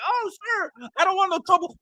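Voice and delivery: high, timid put-on voice of a guy avoiding a fight; meek mock plea. Commentator mocking the fighter's fake-humble act, high and meek. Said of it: "Just act like".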